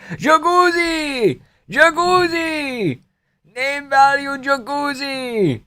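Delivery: high, slow, drawn-out strained shouts; Merab impression. Streamer mimicking Merab calling out, high and slow. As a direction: stretch each word high like Merab. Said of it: "I have a good Marab impression".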